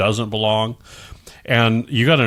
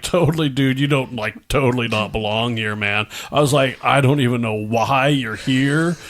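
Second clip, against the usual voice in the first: surfer dude; 3 semitones higher than usual. Retold or imitated. Surfer telling an outsider he doesn't belong, surfer dude. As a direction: play the local surfer dude. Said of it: "I started talking to the surfer".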